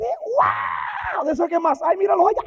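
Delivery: high, loud crowing guide; gleeful shout. Acted. Hell's guide shouting to Wesley about burning crowds. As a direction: yell it out like a showman.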